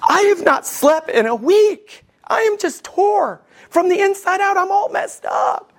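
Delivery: high, hammed-up anguish; emotional wreck. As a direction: act out a mock meltdown, pitch climbing steeply. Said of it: "you're just an emotional wreck".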